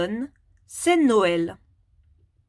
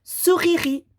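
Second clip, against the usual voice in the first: sourierie sung out higher and louder; bright, higher teacher reveal; playful high reveal; 7 semitones higher, 3 dB louder than usual. Shown as a demonstration. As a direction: present sourierie like a fun surprise for a child. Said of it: "ça donnera".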